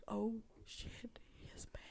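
hushed inner-thought whisper; scared whisper. Retold or imitated. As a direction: whisper it to yourself in mock dread. Acts out her silent inner reaction.

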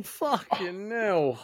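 drawn-out slow curse through the pain; pained groan. Real, not acted. After banging his funny bone, a slow pained curse. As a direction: stretch the words out, hurting.

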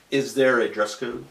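ordinary talk, nothing marked

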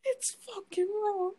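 high-pitched mock chef outrage; Gordon Ramsay. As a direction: imitate a wailing, exasperated chef without shouting. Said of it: "I was watching Gordon Ramsay's".

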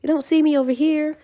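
high, hammed-up scared little voice; mock scared. Mocking men who hide their fear, in a high mock-scared voice. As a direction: pretend to cower in fear, lightly.

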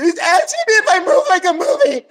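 high, hammed-up tearful wail; mock crying. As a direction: wail the complaint like a bawling schoolgirl. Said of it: "She's, like, crying".

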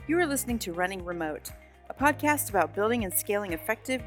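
smooth announcer read over music; podcast intro read. Acted. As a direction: read it like a polished show opener.

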